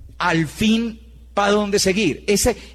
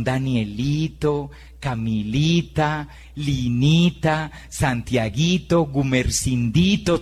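sweet diminutives; 7 semitones lower, slower than usual. Shown as a demonstration. Teacher calling small pupils by pet names, slow and doting. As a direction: drawl each pet name like a fond teacher. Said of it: "los trata por diminutivos".

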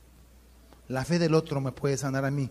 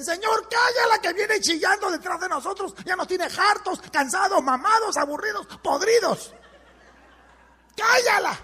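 much higher, fed-up yelling; exasperated shout; 14 semitones higher, 3 dB louder than usual. Acted. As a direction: shout it like you've had enough. Apóstoles hartos piden callarla. (apóstoles hartos gritando a Jesús)

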